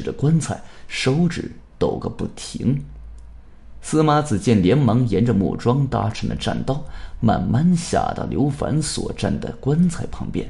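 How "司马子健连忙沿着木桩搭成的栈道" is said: usual speaking voice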